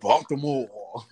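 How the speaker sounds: slow, drawn-out mock Baltimore accent. Mimicking a comedian from Baltimore, exaggerated accent. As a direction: drag the word out in a Baltimore accent. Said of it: "That's why they talk like that".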